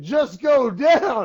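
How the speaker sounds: slow, drawn-out mock yell; imitated yelling. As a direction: stretch it out high, like a fighter taunting. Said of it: "He was yelling at the dude".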